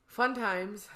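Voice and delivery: normal talking voice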